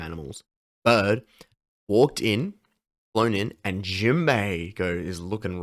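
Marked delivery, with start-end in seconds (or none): none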